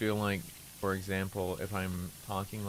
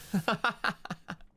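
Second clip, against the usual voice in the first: amused laugh; 13 semitones higher than usual. Real, not acted. Streamer reacting to the answer "both", high giggling laugh.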